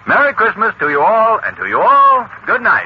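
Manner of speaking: high, ringing holiday send-off; festive farewell. Acted. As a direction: call goodbye cheerily to the whole room. Calling festive farewell to everyone.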